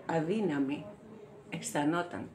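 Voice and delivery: low, restrained delivery; subdued. Acted. Actress telling how weak she felt, low and subdued. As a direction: say it low and contained. Monologue line, first take.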